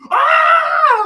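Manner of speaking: very high, loud shriek; wife's shriek. Retold or imitated. Wife shrieking at the replay. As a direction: shriek like she just saw it. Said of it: "She goes".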